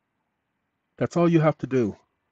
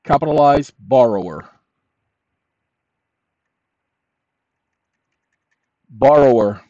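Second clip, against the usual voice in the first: dictating. Shown as a demonstration. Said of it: "Now, watch this".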